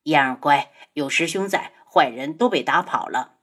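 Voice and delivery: low, hushed, soothing man's voice; gentle coaxing. A narrator's reading. Senior brother comforts frightened 嫣儿, gently coaxing. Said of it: "轻声哄着嫣儿".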